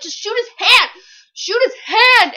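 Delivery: high, loud pleading at a character; re-enacted exasperated yell; urgent yelling. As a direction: shout advice at the book in frustration. Yelling at the book's heroine.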